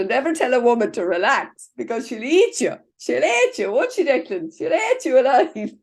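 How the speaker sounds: half-laughing jokey threat; playful warning. Real, not acted. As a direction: egg the room on, mock-scary. Joking aside to Declan, laughing.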